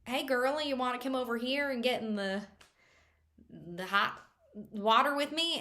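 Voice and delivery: put-on light Southern-accented flirt. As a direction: imitate a Southern come-on. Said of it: "a southern accent".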